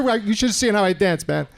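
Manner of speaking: ordinary talk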